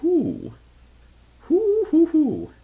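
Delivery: very high, hooting "whoo"; airy owl-like whoo-hoo; high whooping. Real, not acted. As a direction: falsetto little hoots of delight. Delight at card possibilities.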